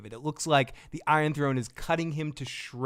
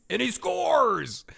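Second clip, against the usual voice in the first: excited commentator; 9 semitones higher, 3 dB louder, much slower than usual. Pretend. Mock sports play-by-play.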